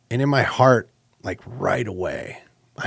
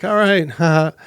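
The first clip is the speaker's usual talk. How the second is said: higher, louder "all right" with a haha; laughing it off; 9 semitones higher, 5 dB louder than usual. Retold or imitated. Player to trash-talking pal, laughing it off. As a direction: laugh off a pal's trash talk. Brushing off pal's smack talk.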